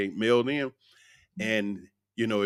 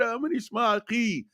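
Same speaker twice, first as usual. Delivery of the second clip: imitating Kobe; 11 semitones higher than usual. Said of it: "Kobe said".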